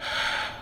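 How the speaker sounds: long voiceless breathy "hhh"; blowing into a harmonica; hot air breath. Shown as a demonstration. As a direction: huff warm air out softly. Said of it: "when your hot air's going through, you can just go".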